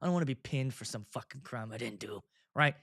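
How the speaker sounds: normal talking voice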